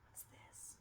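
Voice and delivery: hushed whisper of curiosity; soft secretive whisper. Real, not acted. Reader leans in secretly.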